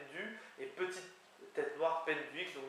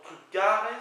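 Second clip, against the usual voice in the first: "trugares" with the accent on "ga", high and loud; stress on second syllable; 5 semitones higher, 12 dB louder than usual. Shown as a demonstration. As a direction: hit the middle syllable of "trugares". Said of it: "l'accent qui est en théorie sur la deuxième syllabe". (accent sur la deuxième syllabe de "trugares")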